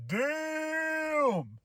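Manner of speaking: long, high, drawn-out impressed damn. Retold or imitated. Vlogger reacting in awe to Cena's insult. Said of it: "And I was like".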